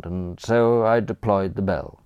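usual speaking voice

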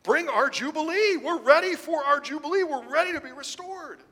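a people calling on God to bring Jubilee, loud and pleading; crying out to God. Acted. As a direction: cry out for rescue with the whole nation's longing. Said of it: "Israel cried out".

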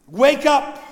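sudden loud call to wake up; preacher calling out the warning; loud command. Acted. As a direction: shout it at the sleeping church.

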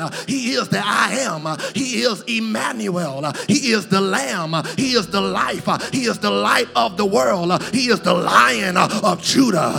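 shouted rhythmic whooping, punched gasps between phrases; full-force preaching. Real, not acted. Preacher feeling the Holy Ghost, full-force shouted litany.

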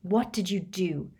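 angry accusing snarl; seething, rising in volume. A narrator's reading. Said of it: "snarled Harry".

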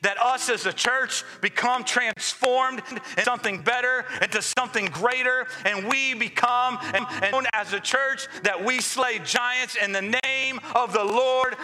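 high, driving preacher call; rally the church with rising fervor; booming motivational speech. Acted.

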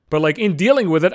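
usual speaking voice

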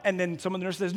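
ordinary talk

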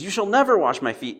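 high, loud, and never falls hard; a loud, impulsive refusal of the foot washing. Retold or imitated. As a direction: blurt out a flat refusal. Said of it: "Peter's the opposite".

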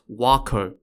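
"Wako" said the Japanese way, as WAH-koh; Japanese pronunciation. Shown as a demonstration. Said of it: "it's pronounced".

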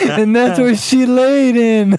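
high and slow, stretched out; drawn-out punchline. Real, not acted. Storyteller revealing where she laid, high drawn-out punchline. Delivers gross punchline to friends.

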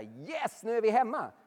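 joyful shout of arrival, rising high and loud; exultant loud homecoming cry; jubilant cry. Retold or imitated. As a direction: cheer like you've finally made it home. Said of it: "De ropar inte så här".

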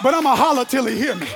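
loud, insistent sermon cadence; full-force preaching. Acted. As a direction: holler it out in rolling parallel phrases.

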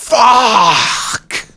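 loud, high outburst of frustration; frustrated curse. Acted. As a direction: blurt it out in dismay. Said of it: "she finally said".

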